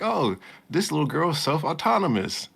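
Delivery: amazed. Retold or imitated. Retelling own reaction to anime.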